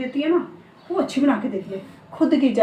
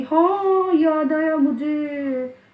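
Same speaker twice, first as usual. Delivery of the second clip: sudden recollection; 5 semitones higher, 5 dB louder, much slower than usual. Real, not acted. Suddenly remembers the samosa.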